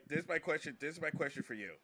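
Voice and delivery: usual speaking voice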